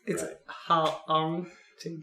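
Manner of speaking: "ha-aunting" stretched very slowly, vowel drawn out; mocking the misspelled title by slowly drawing out "ha-aunting"; misspelled pronunciation. Shown as a demonstration. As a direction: sound out the typo "ha-aunting". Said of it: "haunting is H U A N T I N G".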